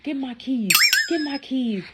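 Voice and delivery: high, mocking imitation of his yell; drunk boyfriend yelling. Narrator mimics her drunk ex demanding his keys, high mock yell. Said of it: "He like".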